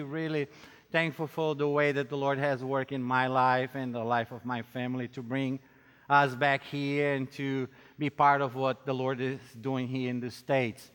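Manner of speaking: normal talking voice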